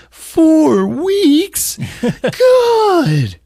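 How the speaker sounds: put-on high, loud outraged kid wail; drawn-out put-on whine; Melvin. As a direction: imitate a kid's exaggerated tantrum. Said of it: "and Melvin's like".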